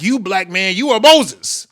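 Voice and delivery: high, loud declaration rising to a peak; mock proclamation. Retelling a man's grand pronouncement about him, loudly proclaimed.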